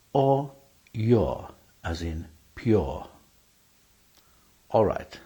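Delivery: usual speaking voice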